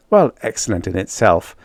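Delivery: plain reading voice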